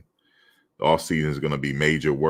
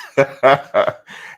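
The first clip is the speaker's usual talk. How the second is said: laugh; 10 semitones higher, 8 dB louder than usual. Real, not acted. Laughing at Jets jab.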